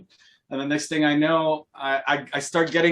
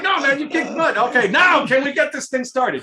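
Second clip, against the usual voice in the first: high, raised-voice retold plea; acted-out good-humoured impatience; mock exasperation; 9 semitones higher, 4 dB louder than usual. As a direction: hurry everyone along over laughter. Retelling organiser's exasperated reply, amid laughter.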